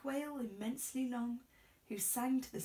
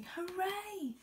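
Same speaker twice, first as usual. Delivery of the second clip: much higher cheer; cheering villagers; 9 semitones higher than usual. A narrator's reading. Villagers cheering the whale, high cheer. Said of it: "villagers shouting".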